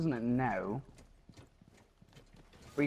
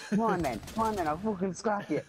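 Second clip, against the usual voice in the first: high, cocky fight challenge; taunting; 7 semitones higher than usual. Pretend. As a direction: goad him into a scrap.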